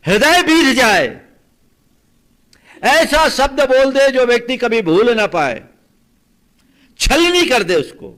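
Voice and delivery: very loud, high-pitched bursts; full-force preaching. Acted. Preacher explaining heart-piercing words to listeners, loud and vehement. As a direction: proclaim each phrase like a blow.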